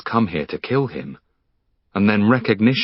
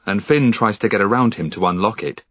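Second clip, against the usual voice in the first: ordinary delivery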